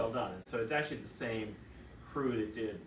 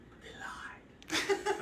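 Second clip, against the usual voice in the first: conspiratorial whisper; much slower than usual. Retold or imitated. Sedated patient confiding to her doctor, hushed whisper. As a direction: whisper a secret she believes. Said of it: "She goes".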